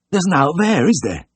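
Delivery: high, worried character voice; anxious whisper. A narrator's reading. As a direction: ask anxiously, dreading the answer. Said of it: "Mister Worley whispered anxiously".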